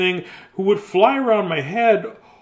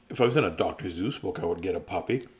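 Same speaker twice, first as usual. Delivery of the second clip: wistful mutter; 10 semitones lower, 7 dB quieter, much faster than usual. Real, not acted. Wistful complaint after sigh.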